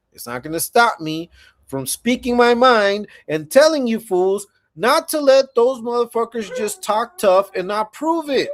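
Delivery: usual speaking voice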